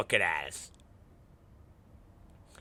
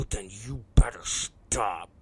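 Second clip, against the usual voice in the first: low, slow, near-whispered warning; hushed threat; 6 semitones lower, 6 dB quieter, slower than usual. Retold or imitated. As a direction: threaten the kid under your breath. Hushed threat after child's scream.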